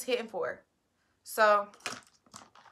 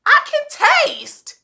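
high, slow, amazed; excited disbelief; 8 dB louder than usual. Retold or imitated. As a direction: gasp it out in wonder. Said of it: "I'm like".

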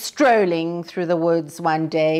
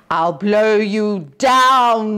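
wolf; 3 semitones higher, 7 dB louder, much slower than usual. A narrator's reading. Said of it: "the wolf replied".

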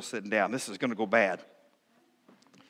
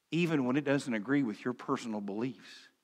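normal talking voice

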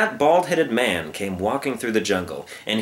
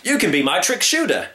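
excitable circus man; 7 semitones higher than usual. Acted.

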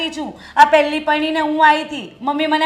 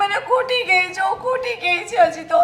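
fake sobbing; 4 semitones higher than usual. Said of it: "તું કંઈક રોવાનું બંધ કર".

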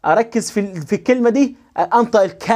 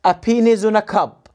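words linked into one smooth phrase; connected, measured reading drill; joined-up model reading; much slower than usual. Shown as a demonstration. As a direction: run the words together without gaps. Same sentence read joined up.

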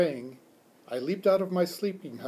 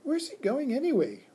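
put-on high-pitched girl's question; high, puzzled schoolgirl voice; curious child; 11 semitones higher than usual. A narrator's reading. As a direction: wonder aloud in a child's voice. Curious child asks about the pet.

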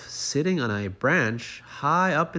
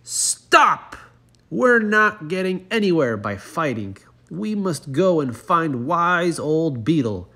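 much higher, loud commanding shout; shouting chief frog; 6 semitones higher, 7 dB louder than usual. Acted. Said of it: "the chief frog shouted out".

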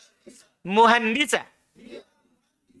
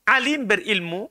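ordinary delivery

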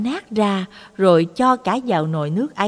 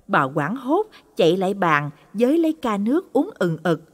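plain reading voice

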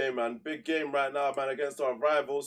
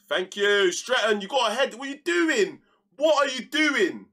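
loud, high exasperated yelling; furious shout; 9 semitones higher, 7 dB louder than usual. Real, not acted. Gamer yelling at his defender for a missed header, furious shout. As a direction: yell in disbelief at a bad play.

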